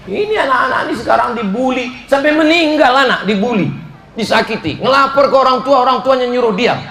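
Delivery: high, fast and heated; rising indignation. Real, not acted. Preacher rails at the audience over bullied children, heated.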